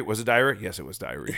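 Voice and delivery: ordinary delivery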